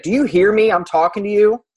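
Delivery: high, loud, imitating an irate caller; mock angry demand. Woman scolding someone who won't respond, mock angry yell. Retold knockdown fight on a call.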